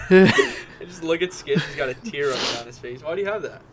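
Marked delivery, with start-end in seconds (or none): 0.0-1.6 loud, slow, laughing through the words. Real, not acted. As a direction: barely get it out for laughing. Laughing at co-host's tear.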